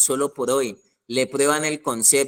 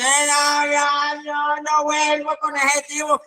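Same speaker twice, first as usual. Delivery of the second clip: much higher, hammed-up whimpering promise; high mock sob voice; whiny vow; 9 semitones higher, slower than usual. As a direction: wail it like a tearful girlfriend swearing off him. Mocking the weeping friend.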